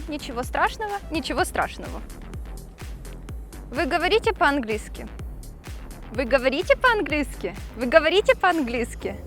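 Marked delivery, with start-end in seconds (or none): none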